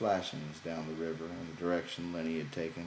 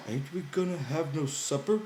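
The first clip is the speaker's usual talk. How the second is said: put-on higher, childlike simple man; Lenny's voice; 9 semitones higher, 3 dB louder than usual. A narrator's reading. Lenny promising George he won't talk, higher childlike voice.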